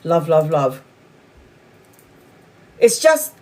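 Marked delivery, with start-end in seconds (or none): 0.0-0.8 low, even mantra; self-calming love mantra. Retold or imitated. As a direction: murmur it to calm yourself. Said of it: "I just think, "Love, love".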